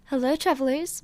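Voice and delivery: ordinary delivery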